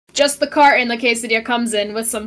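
ordinary talk

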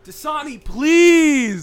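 high pleading cry; mock begging. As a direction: beg like a desperate host. Begging for sponsor.

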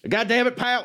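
put-on Earl's accent. Quoting Earl cursing at the wrestler, hammed-up accent impression. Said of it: "what's his accent sound like".

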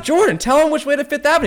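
loud, high, hammed-up urging; urgent plea to friend. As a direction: press your friend loudly to answer. Acts urging his friend to answer.

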